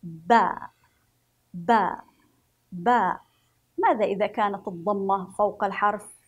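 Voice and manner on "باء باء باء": باء said with an open a, loud and low; drawn-out ba sounds. Shown as a demonstration. Teacher demonstrating the fatha sound to children, loud and clear. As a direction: call out باء with a full fatha. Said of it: "نقرأ بها الحرف بالفتح هكذا".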